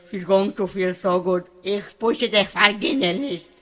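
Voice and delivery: ordinary talk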